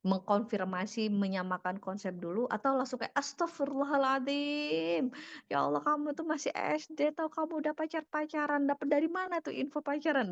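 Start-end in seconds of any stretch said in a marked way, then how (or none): 3.2-10.3 shocked parental rant. Acted. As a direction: launch straight into a high-pitched, appalled telling-off. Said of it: "Udah langsung nyerocos gitu ya".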